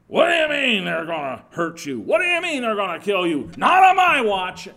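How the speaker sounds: loud, rising protest, peaking in a shout; indignant shout building to the top of his voice; Peter shouting. Retold or imitated. Peter protesting Jesus will die, shouting. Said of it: "as Peter's shouting that".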